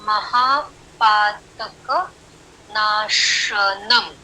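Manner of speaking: very slow, spacing each syllable for counting; slow counting recitation. Shown as a demonstration. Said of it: "यहाँ पर भी आठ".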